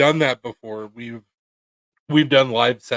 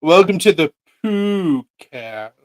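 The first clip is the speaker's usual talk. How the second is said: podcast host voice; 4 semitones higher, 7 dB louder, much slower than usual. Pretend. Mock podcast intro.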